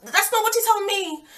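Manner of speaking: high-pitched incredulous retort; indignant disbelief. Retold or imitated. Confronting the cheating man in front of his girlfriend, indignant. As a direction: act out a squealed, disbelieving challenge.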